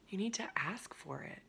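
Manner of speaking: soft whisper, leaning in; whispered secret. Real, not acted. Coach confiding the key advice to her audience in a whisper.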